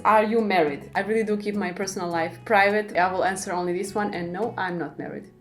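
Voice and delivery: usual speaking voice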